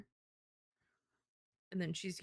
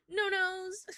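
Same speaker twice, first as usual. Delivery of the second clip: no nose, jumping up high and louder; high decisive repeat; 12 semitones higher, 5 dB louder than usual. Real, not acted. Streamer declaring the drawing will skip the nose, high and loud. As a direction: sing it out up high.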